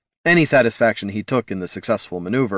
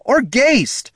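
high, urgent shout; 4 dB louder than usual. A narrator's reading. Mage calling to companions in battle, shouted. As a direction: finish the shout in exasperation. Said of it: "shouted Loruna".

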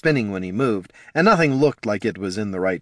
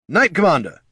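raised shout across the hall; calling out; 9 semitones higher, 4 dB louder than usual. A narrator's reading. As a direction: call out to get his attention. Officers calling out to him.

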